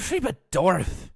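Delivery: loud, bratty whine; whiny voice. Acted. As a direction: complain it like a brat. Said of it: "the whiny little bitch ones".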